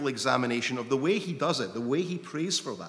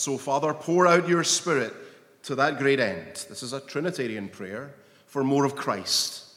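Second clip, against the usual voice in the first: rising, louder preaching push toward the Spirit; fervent preaching; 2 semitones higher, 6 dB louder than usual. Acted. Preacher urging his congregation toward more of Christ, fervent and loud. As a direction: preach it out with full force.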